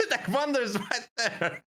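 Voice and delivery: high laughing through the words. Real, not acted. Storyteller laughing hard that Vander was right there. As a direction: crack up as you repeat it. Cracking up retelling.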